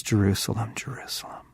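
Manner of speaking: trailing from soft into an unvoiced whisper; aching lament. Pastor voicing Jesus' longing, fading to a whisper. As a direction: let the name fade to a breath. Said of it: "he says".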